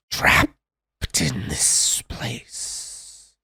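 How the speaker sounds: slow, hollow whisper from beyond the grave; ghostly whisper. Acted. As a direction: breathe it out like a trapped spirit. GM voices the spirit.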